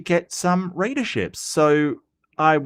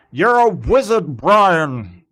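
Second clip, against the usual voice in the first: higher, louder put-on character voice; mock wizard movie line; 4 semitones higher, 6 dB louder, slower than usual. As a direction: boom out the famous line in a hammed-up character voice. Mock Harry Potter line.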